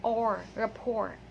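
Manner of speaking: "or" drawn out, then "rapport" as ra-POR; silent T. Shown as a demonstration. Teacher showing the ending, silent T. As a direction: slowly sound "or", then "rapport" without T. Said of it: "the last letter T is silent".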